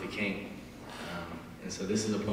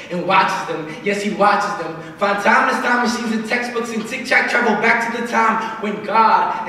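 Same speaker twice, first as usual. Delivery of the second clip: rhythmic slam poetry delivery, building louder in the middle; spoken word poem; 10 semitones higher, 9 dB louder than usual. Acted. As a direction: perform it like a slam poet on stage. Performs his spoken word poem.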